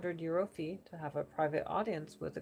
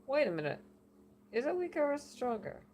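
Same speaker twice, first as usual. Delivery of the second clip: puzzled New York oracle; a hint of a confused New Yorker; bad New Yorker accent; 8 semitones higher than usual. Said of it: "New Yorker accent".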